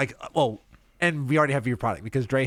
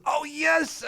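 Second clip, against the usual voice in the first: high excited exclamation; 13 semitones higher than usual. Real, not acted. Host thrilled by the cartoon voice. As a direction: burst out with delight.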